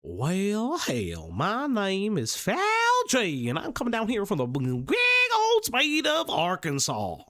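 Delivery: slow hammed-up southern drawl; lightly put-on Arkansas accent; mock southern accent. Streamer answering a tip with a southern accent. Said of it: "if you ever do a southern accent again".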